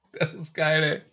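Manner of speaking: said softly under a laugh, half to himself; a hushed, amused first reaction; quiet amused aside. Real, not acted. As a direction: murmur "Das ist geil" softly, as if still taking the joke in. Reacting to "make parents proud.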